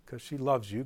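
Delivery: ordinary talk, nothing marked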